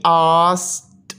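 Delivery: आस्क्ड drawn out, then a detached "t"; ending pronounced t. Shown as a demonstration. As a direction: stretch आस्क्ड as आस... ट. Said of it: "फिर टी की आवाज़ है". (आस्क्ड को खींचकर आख़िर में अलग "ट")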